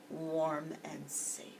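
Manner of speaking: soft slow whisper; reading bedtime story, whispering the cozy end. A narrator's reading. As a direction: whisper it like a lullaby.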